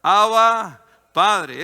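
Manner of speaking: loud, emphatic cry to the Father; proclaimed loud, then falling away. Acted. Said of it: "les permite clamar".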